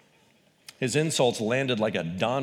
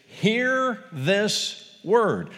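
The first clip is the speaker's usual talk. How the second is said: "Hear this word" drawn out very slowly and higher, weight on "Hear"; slow solemn proclamation; 8 semitones higher, much slower than usual. Shown as a demonstration. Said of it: "each beginning with the phrase".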